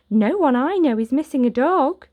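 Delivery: chirpy neighbour brushing him off. Acted. Neighbours telling Oliver nobody lost a dog, chirpy.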